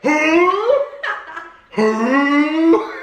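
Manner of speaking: high, drawn-out hooting call; mock yelled horse call. Rider trying to slow the horse, mock yelled call. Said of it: "now I'm yelling".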